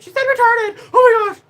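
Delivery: high, loud scandalised shriek; mock outrage. Mimicking outraged viewers, high and loud scandalised shriek. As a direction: squeal like a shocked prude. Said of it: "Everyone's gonna be like".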